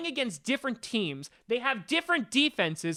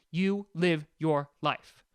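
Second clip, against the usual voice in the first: word-by-word YOU LIVE YOUR LIFE, lower; slow, emphatic; 5 semitones lower, slower than usual. Real, not acted. Repeats it slowly for emphasis.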